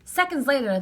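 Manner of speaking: plain reading voice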